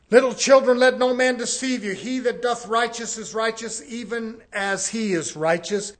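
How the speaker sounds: loud, driving scripture reading; preaching at full force. Acted. Preacher pressing the verses on his congregation, loud. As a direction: hammer each line home to the church.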